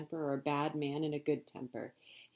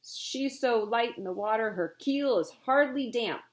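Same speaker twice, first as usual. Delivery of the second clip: loud ranting captain; bellowing; 5 semitones higher, 5 dB louder than usual. A narrator's reading. Captain bellows about the rival ship's light cargo. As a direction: rant about the rival ship. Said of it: "Captain Swain bellowed".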